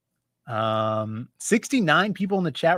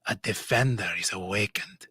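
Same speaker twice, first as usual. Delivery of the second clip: put-on sneaky Middle Eastern narrator accent; 5 semitones lower than usual. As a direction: intone a dark tale in a Middle Eastern accent, dropping toward a whisper. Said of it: "sneaky Middle Eastern who snuck into France".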